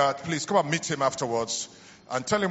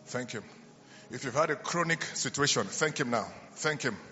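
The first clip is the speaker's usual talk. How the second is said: soft, low, fading murmur of prayer; hushed prayer; 3 semitones lower, 7 dB quieter than usual. Acted. Pastor leading a silent healing moment, soft and low. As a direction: hush the room and lead thanks softly.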